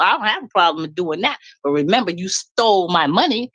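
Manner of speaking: ordinary talk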